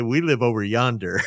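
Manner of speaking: loud, hammed-up Southern accent; imitated Southern belle. Southern wife answering Detroit neighbors, imitated Southern accent. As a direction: belt it out like a Southern gal, teasing. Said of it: "Spoken like a true Southern belle".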